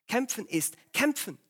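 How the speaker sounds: ordinary delivery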